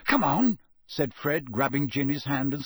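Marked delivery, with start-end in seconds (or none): 0.1-0.6 high, loud urgent call. Acted. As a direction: hurry them along. Fred urging everyone to flee.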